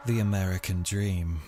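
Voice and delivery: hushed documentary voice opening a murder story; dramatic podcast intro. Acted. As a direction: read it like a true-crime trailer. Dramatic true-crime intro read.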